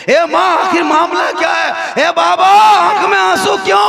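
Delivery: high, loud demanding call; a child insisting on an answer; insistent cry. Acted. Young Husain insisting to his mother and father, loud cry. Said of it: "तो इमाम हुसैन जिद करने लगे".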